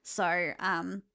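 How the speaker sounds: ordinary talk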